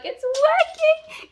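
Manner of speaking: high, slow, drawn-out "It's working!"; gleeful squeal. Retold or imitated. Reader squealing over a book scheme working. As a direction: stretch it out in triumph.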